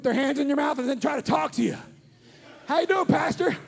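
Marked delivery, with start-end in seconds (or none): 2.7-3.6 lightly voiced chirpy dentist, last line shooting high; a hint of a cheery dentist; mock dentist chatter. Pastor imitating dentist chatting to a patient, mock cheery. Dentist talking while hands in mouth.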